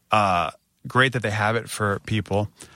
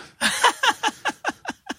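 sharp high laugh; rueful laugh. Real, not acted. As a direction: laugh at the absurdity. Locked out barefoot.

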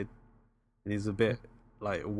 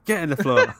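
mock Scottish accent; 5 semitones higher, 8 dB louder than usual. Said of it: "mock Scottish line".

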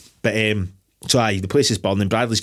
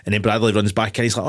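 normal talking voice

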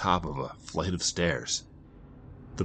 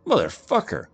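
raised, loud angry snarl; 9 semitones higher, 6 dB louder than usual. A narrator's reading. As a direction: snarl the curse. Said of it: "snarled Donnie".

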